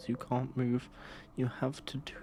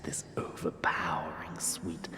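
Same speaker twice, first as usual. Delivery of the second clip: slow, low villain whisper; a sinister king whispering close; menacing king whisper; 5 semitones lower than usual. Acted. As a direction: breathe the threat into their ear. King threatens, held whisper voice.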